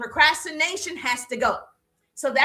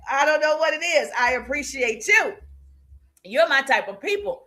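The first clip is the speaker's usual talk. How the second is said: ordinary talk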